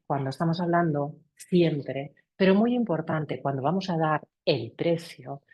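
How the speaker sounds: usual speaking voice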